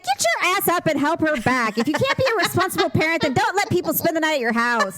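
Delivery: high, mock-indignant telling-off; stern scolding. Telling off irresponsible parents, mock indignant. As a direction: scold those parents into action. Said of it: "And I'd be like".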